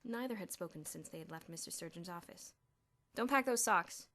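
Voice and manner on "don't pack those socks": sharp sudden snap; snapping. A narrator's reading. Bruno snapping at his roommate over socks, loud. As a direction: bite it off quick and louder. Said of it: "Bruno snapped suddenly".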